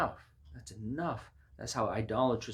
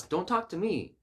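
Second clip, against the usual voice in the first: high, louder mock protest; defiant refusal; 10 semitones higher, 5 dB louder than usual. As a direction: push God away with a raised voice. Acting people rejecting God's word.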